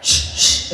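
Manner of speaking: two sharp voiceless shushes; imitated shushing noise. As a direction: imitate his dismissive "shh, shh". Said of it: "he would just be".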